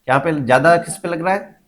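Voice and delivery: usual speaking voice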